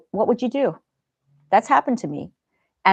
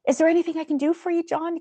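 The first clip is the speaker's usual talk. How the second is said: quick, much higher, overly sweet question; fake-nice hurry to get rid of him; polite brush-off; 11 semitones higher than usual. Her own polite brush-off retold.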